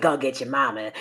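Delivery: imitating a man ranting low; mock rant. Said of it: "now he's talking like this".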